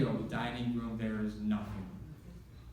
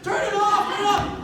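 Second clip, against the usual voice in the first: panicked yell; 13 dB louder than usual. Retold or imitated. Nephew panicking.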